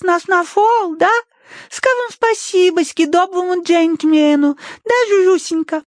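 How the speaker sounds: put-on high lisping baby talk; cutesy baby talk. A narrator's reading. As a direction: coo and lisp to a pet dog. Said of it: "сюсюкала Тосси".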